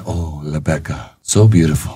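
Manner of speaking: hushed mock admiration; imitating admiring woman. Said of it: "And the woman was like".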